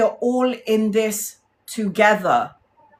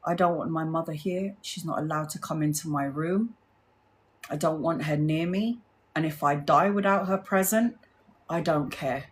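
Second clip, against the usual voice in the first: quiet retold refusal; 4 semitones lower, 6 dB quieter than usual. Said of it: "she said to the nurses".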